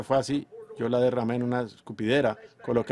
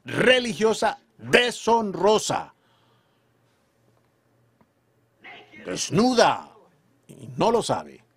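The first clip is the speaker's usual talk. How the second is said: fervent preaching; 3 semitones higher than usual. Acted. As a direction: preach it hard to the congregation. Preaching at full force.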